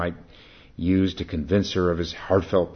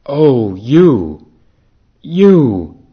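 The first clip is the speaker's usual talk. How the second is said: building higher and louder calls; crying out; 10 semitones higher, 18 dB louder, much slower than usual. Acted. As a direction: cry out more with each repeat. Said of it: "he cried out".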